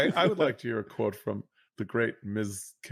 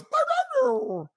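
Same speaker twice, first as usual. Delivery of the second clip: very high squeaky meows; dog meowing; much slower than usual. Retold or imitated. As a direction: mimic a dog trying to meow, way up high. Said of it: "and it goes".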